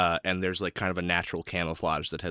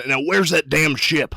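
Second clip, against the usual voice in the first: Guarn; 7 semitones higher, 7 dB louder than usual. Acted. Guarn demands the ship.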